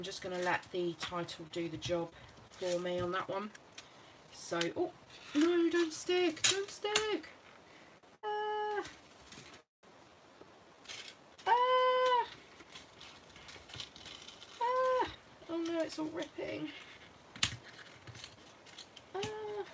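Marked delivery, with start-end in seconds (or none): 4.7-7.3 high, pleading don't stick; urgent, alarmed plea; panicked. Real, not acted. Sticker tearing as she works. 8.2-15.1 high dismayed ah; sharp cry of alarm; distressed cry. Real, not acted. Sticker ripping. 15.5-19.6 dismayed. Real, not acted. Sticker ripping.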